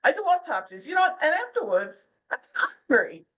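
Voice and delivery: a bad New Jersey tough-guy Newark accent; cartoonish Newark accent on the punchline; terrible Newark accent. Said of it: "I do it in a terrible Newark accent".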